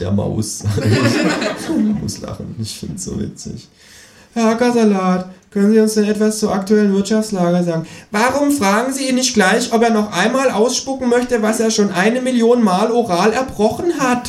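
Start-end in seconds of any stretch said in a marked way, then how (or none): 4.3-7.9 much higher piping voice; squeaky host. Acted. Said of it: "piept die Moderatorin". 8.1-14.3 high, mocking kangaroo voice. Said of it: "Fährt das Känguru dazwischen".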